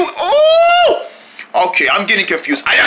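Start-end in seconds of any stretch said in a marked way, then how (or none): 0.0-1.7 a high wailing cry as it hits; high crying shriek. Acted. A kid about to be hit cries out in a shrill wail. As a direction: shriek out in pain and panic.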